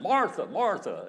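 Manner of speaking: preacher voicing the Lord's loud call. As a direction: call her name twice, emphatically. Lord calling Martha by name twice.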